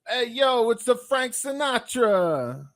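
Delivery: high, loud wiseguy crooner voice. Retold or imitated. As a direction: ham up a swaggering New York crooner. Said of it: "impersonation of each of these".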